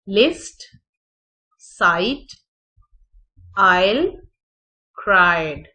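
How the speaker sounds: लिस्ट with a short i, साइट, आयल and क्राइड with ai; loud model words, one at a time; odd-one-out test words; careful word demo. Shown as a demonstration. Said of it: "लिस्ट में short vowel sound".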